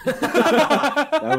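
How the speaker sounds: high, loud laughing insistence. Real, not acted. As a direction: laugh it out, slowly. Laughing at own mix-up.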